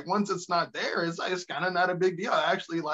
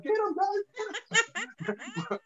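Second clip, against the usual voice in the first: much higher, crying out; panicked Trump yell. Retold or imitated. Trump flees the stage and yells at the camera crew, panicked. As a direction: squeal it in a panic.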